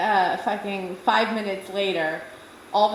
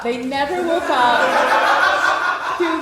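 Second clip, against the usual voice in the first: laughing; 3 semitones higher, 4 dB louder, much slower than usual. Real, not acted. Laughing at absurd scene.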